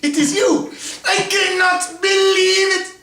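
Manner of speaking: high, loud, astonished shout; dramatic surprise. Pretend. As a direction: overact the shocked reunion. Mock dramatic reunion.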